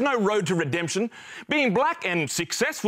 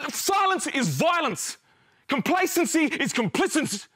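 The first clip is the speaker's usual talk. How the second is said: impassioned, high-pitched rallying cry; fervent protest speech, pitched up; defiant declaration; 5 semitones higher than usual. Acted. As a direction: proclaim it like a manifesto. Defiant climax of protest monologue.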